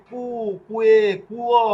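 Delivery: কু, কুয়ে, কুয়ো high and loud; model qu pronunciation. Shown as a demonstration. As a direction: sound a clear u glide in কুয়ে, কুয়ো. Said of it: "কিন্তু এটা হবে".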